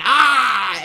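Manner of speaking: high loud mock cry; retold cry. Evil Panthro burned by sword light, mock cry. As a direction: yelp like a cartoon villain burning. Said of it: "and he went".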